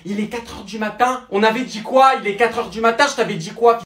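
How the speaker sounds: loud, high furious rant at him; furious yelling. Acted. Girlfriend screaming at boyfriend who came home drunk, furious shout. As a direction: tear into him at full volume.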